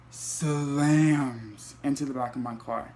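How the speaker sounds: each word drawn out, slow and heavy; slow emphatic repeat. Retold or imitated. Retelling the crash to viewers, slow and weighty. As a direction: lean on "Slams" and let the line land slowly.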